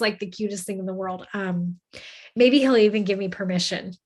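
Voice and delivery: ordinary talk, nothing marked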